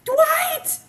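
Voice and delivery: high, loud cry of the name; shrill-pitched scandalized yell; scandalized exclamation. Real, not acted. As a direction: call out the name in amused shock. Mock scolding the absent son.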